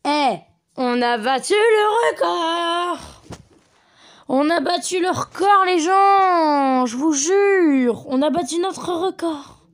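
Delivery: loud, high cheer with drawn-out vowels; elated celebration. Real, not acted. Gamer celebrating to viewers that the record fell, elated shout. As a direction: shout it out, stretching the last words.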